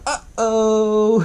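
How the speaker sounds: high, sing-song little creature uh-oh; Gizmo. Retold or imitated. Retelling the creature watching the water bubble, high imitated uh-oh. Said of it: "Gizmo's like".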